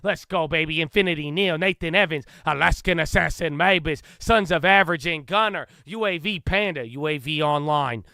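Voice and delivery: loud, punchy announcer greeting each name; hype shout-out roll call. Real, not acted. Hyped livestream roll call of viewers.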